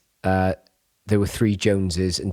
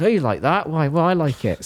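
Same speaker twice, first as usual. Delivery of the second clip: higher-pitched, hinted grandparent imitation; lightly voiced grandparent; 8 semitones higher than usual. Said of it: "You know, like your grandparents".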